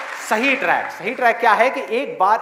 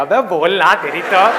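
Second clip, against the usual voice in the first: mock angry; 3 semitones lower, 6 dB louder than usual. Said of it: "लोग बौखला जाएंगे".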